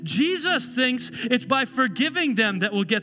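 high, emphatic pulpit voice; full-force preaching. Acted. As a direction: drive each point home with fervour.

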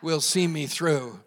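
projected, emphatic pulpit cadence; fervent preaching. Real, not acted. Pastor declaring an unchanging God, fervent preaching. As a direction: build a list toward a ringing cry.

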